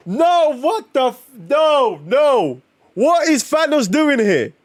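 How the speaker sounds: high, pressed-out protests; disbelief. Real, not acted. Watching Thanos show up, high disbelieving protest. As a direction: keep insisting no, voice up high.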